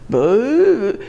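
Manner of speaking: "Boo" drawn out on a long upward glide; sounding out. Shown as a demonstration. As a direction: stretch "Boo" slowly, sliding the pitch up and back. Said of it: "saying the word, trying to figure out".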